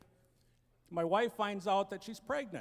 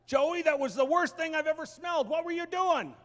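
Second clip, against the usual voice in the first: loud, high disgusted cry; disgusted outburst; 6 semitones higher, 6 dB louder than usual. Retold or imitated. Dad reacting to his son's finger, loud and disgusted. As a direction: recoil and yell at the kid.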